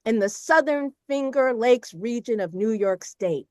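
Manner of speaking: ordinary talk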